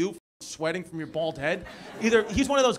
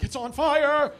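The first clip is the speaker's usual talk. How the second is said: louder, high-pitched alarmed cry; mock alarm; 7 semitones higher, 5 dB louder, much slower than usual. Comic imitating alarmist news channels, mock alarmed shout. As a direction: imitate a panicked news report.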